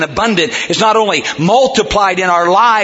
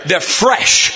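ordinary talk, nothing marked

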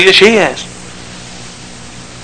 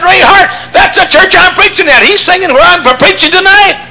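excited shout; 10 semitones higher than usual. Retold or imitated. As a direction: burst out in delight. Excited realization, shout heard.

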